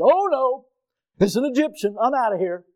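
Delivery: lightly hammed-up dismay at an Egyptian doctor; mock alarm. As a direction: pretend to balk at the doctor. Mock prejudiced patient reaction.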